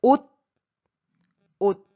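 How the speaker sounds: 옷 ending in a stopped T; teacher's isolated model of 옷; final T. Shown as a demonstration. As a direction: close 옷 on a T, not an S. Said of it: "Donc, ça se prononce".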